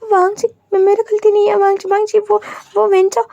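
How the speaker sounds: high, tearful, frightened pleading; a trembling servant begs his master, voice cracking; trembling plea. Acted. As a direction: beg through tears, shaking with fear. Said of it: "वो काँप रहा था".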